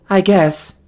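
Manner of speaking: high, lightly put-on amusement; affected chuckle. A narrator's reading. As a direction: answer as if faking a small chuckle. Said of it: "I affect a chuckle and answer".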